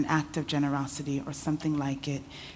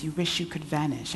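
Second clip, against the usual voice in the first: usual speaking voice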